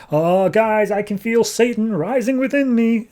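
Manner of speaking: high-pitched panicky whine; mock freak-out. Burden wailing to the team in high mock panic. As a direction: squeal it like he's losing it. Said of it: "Burden at this point is, like, freaking out".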